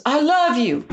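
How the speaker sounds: high, loud retold exclamation; imitated excited declaration. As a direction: exclaim it as the eager man would. Acting witch doctor's repeated declaration.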